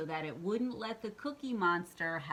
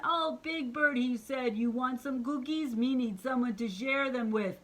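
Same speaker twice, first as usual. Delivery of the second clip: monster voice; 6 semitones higher, 5 dB louder than usual. Acted. Monster offers cookies.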